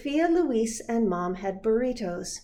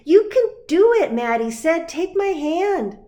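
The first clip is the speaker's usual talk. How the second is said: encouraging cheer; 9 semitones higher, 11 dB louder than usual. Acted. Maddie encouraging Sophia on wall.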